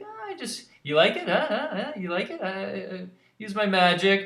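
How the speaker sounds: put-on fishing for praise like a kid; Nimona bragging. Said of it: "she's like".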